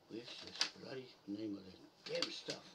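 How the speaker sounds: usual speaking voice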